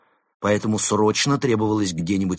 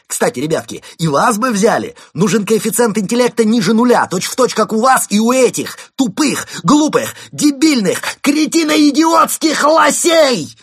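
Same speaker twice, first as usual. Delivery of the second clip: near-shout building to yell; 13 semitones higher, 10 dB louder than usual. A narrator's reading. Константин insulting moose police, building to a full yell. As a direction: pile up insults, louder and higher each time. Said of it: "почти кричал Константин".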